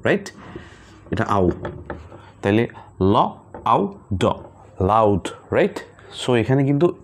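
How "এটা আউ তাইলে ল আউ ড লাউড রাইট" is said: লাউড built sound by sound, ল then আউ then ড; sounding out a word. Shown as a demonstration. Teacher sounds out লাউড for students, blending slowly. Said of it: "এই সাউন্ডটাকে বলা হচ্ছে আউ sound".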